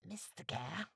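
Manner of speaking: soft hushed address, rising; a quiet call to a crewmate; whisper. A narrator's reading. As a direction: murmur the name. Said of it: "Calico Jack whispered".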